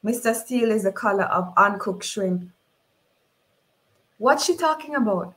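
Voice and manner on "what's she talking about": high-pitched, puzzled question; baffled onlooker. Acted. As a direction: voice a bewildered character, pitched up. Puzzled character questions the woman.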